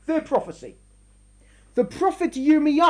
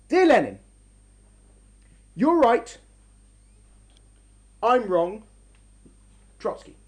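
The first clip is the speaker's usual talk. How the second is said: triumphant reading; 4 semitones lower than usual. Shown as a demonstration. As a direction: read it as a humiliating surrender. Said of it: "Listen to this letter from Trotsky".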